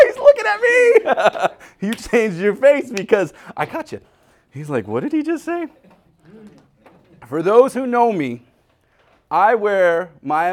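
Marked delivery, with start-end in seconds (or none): 0.1-1.6 high, slow, laughing through the line; laughing mock reply. Preacher laughing as he voices a caught listener. 4.9-5.7 high, puzzled listener's thought; mock startled listener. Preacher voicing a listener's startled thought, high and puzzled.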